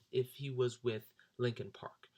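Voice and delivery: usual speaking voice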